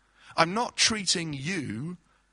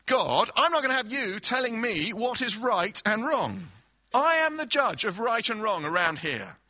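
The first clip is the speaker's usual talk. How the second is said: raised, indignant voice talking back to God; defiant Cain; 5 semitones higher, 4 dB louder than usual. Acted. Cain rebuking God, indignant and raised. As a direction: Cain telling God off, high and loud.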